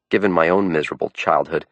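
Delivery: ordinary delivery, nothing marked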